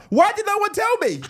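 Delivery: high, loud, slow wail; mock despair shout. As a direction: howl it in mock anguish. Mock despair about Captain Stink.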